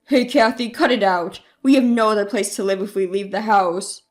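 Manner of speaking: low, forceful father's voice; fussing parent. A narrator's reading. Father protests to daughter about losing the house, low and fussing. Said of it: "my parents started to make a fuss".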